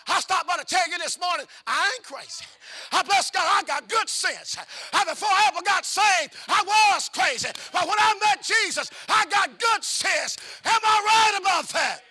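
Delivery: preaching climax. Acted. Preacher testifying to the congregation, loud and climbing. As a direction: whoop it up to the church, climbing.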